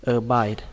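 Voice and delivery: "Abide" ending on a clear final D; final d sound. Shown as a demonstration. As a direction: land the D at the end of "Abide". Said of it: "kết thúc bằng âm đờ".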